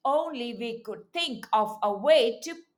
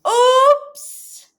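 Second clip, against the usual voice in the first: loud high oops; 9 semitones higher, 10 dB louder than usual. Acted. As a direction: caught off guard by the fire. Dragon sneezed fire.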